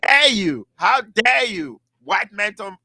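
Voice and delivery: high, slow outraged how dare you; mock outraged audience. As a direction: act scandalized, dragging out dare. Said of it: "they're like".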